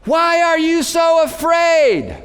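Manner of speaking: high, loud, slow shout; shouted question. Acted. Jesus shouting his question over the storm, slow shout. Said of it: "He's having to yell this".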